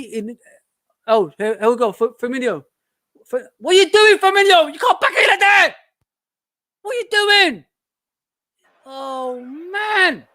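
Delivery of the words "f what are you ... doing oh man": loud, much higher disbelieving yell; outraged fan yelling at the striker; furious shout. Real, not acted. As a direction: scream at the player's blunder. Fan furious at player's mistake.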